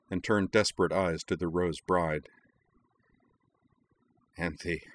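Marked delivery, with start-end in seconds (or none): 4.4-4.9 soft, fading plea; barely enough breath for her name; said weakly. Acted. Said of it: "he said weakly".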